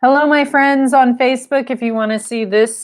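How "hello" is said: ordinary talk, nothing marked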